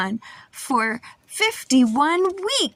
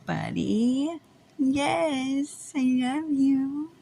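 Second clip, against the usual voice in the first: reassuring; 5 dB quieter, slower than usual. Real, not acted. Parent soothing a child.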